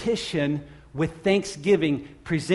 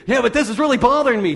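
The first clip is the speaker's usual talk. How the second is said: louder, higher, fast mock complaint; whiny excuse; 6 semitones higher, 7 dB louder, faster than usual. Preacher mimicking a worrier's protest, mock whiny. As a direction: play the fretting believer protesting.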